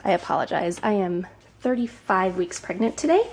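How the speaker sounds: ordinary talk, nothing marked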